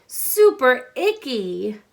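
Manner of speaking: slow, loud, drawn-out disgust; grossed-out. Acted. Teacher reading to children, slow loud playful disgust. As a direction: savour the yuck for the kids. Rhyme punchline in storybook.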